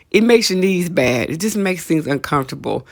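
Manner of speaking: ordinary talk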